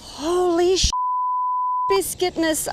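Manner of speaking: high, loud shocked outburst; blurting it out in shock; surprised shout. Real, not acted. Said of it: "I'm sorry, I swore".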